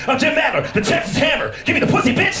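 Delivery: high, shouted TV-ad bluster; Jim Adler yell. Retold or imitated. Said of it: "Be like".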